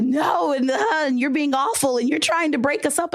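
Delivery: high, shrill-sounding mock drama; dramatic accusation. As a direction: play her as overwrought and accusing. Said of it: "And she dramatic".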